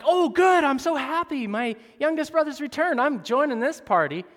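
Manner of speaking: high, loud mock glee, sarcastic; mock delight. Older brother pretending to be glad for the party, mock cheer. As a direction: gush with fake happiness. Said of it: "isn't like".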